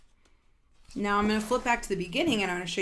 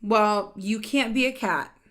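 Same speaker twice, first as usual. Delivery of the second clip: louder, lively read-aloud of a character line; animated storybook voice; 2 semitones higher, 7 dB louder than usual. Acted. Reading a picture book to children, animated and louder. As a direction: voice the characters brightly for the kids.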